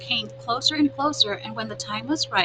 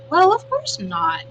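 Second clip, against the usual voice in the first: drawn-out, higher, louder "Well" of mock offence; amused scoff; 10 semitones higher, 6 dB louder, slower than usual. Opossum laughing off the robot's question, drawn-out scoff.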